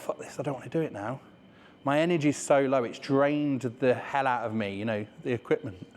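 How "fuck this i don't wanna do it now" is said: fast, soft fed-up grumble; a quick, soft, defeated grumble; fed-up mutter. Retold or imitated. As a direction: throw in the towel under your breath. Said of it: "And then I thought".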